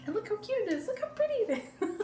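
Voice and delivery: high cute gush, laughing at the end. Real, not acted. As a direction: coo at something adorable. Gushing over the pink tea.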